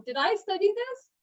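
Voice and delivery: high, rising self-doubting question; doubtful self-questioning. Acted. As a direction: ask yourself in doubt, pitch climbing to the end. Acting out a student's panicked doubt.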